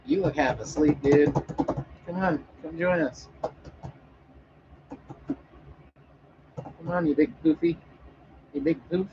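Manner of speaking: high cooing voice calling the kitty. Real, not acted. Calling the sleepy cat over to join, high cooing baby talk.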